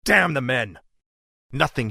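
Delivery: loud furious snap; angry outburst. Acted. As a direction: spit it out in fury. Prince rejects friend's plea angrily.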